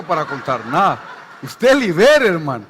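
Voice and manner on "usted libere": louder, higher, laughing command to deliver; emphatic command. Real, not acted. Pastor urging believers to deliver: loud laughing command. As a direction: push them to act, loud. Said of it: "emphatic command".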